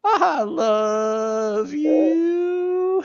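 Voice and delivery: high, very slow, weepy preacher voice; Brother Love impression. Doing Brother Love for friends, high tearful drawl. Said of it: "I guess I can do a Brother Love".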